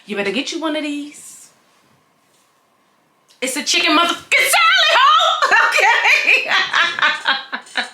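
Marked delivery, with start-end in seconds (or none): 3.4-5.2 laughing shout. Real, not acted. Hyped shout through laughter. 5.5-7.9 laughing. Real, not acted. Laughing after shout.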